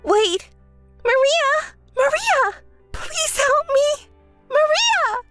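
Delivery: high, frantic cries of her name; desperate calling out. Acted. Sister calling after Maria as she hangs up, high and frantic.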